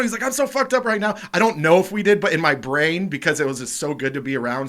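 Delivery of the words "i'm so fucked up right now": high, loud mock outburst; excited echoed confession. High friend answers his fellow tripper, excited. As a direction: blurt it back just as high and urgent.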